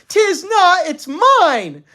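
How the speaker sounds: very high, loud retort; shrill quarreling. A narrator's reading. Mother throwing father's words back, shrieking in quarrel.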